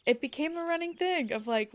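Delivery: ordinary delivery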